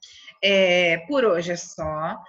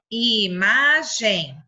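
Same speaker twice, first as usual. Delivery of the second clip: Imagem stretched very slow and louder; drawn-out word; 5 dB louder, much slower than usual. Shown as a demonstration. Teacher dictates to children, slow and drawn out. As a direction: say Imagem slowly so kids hear each syllable. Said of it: "separa as sílabas de imagem".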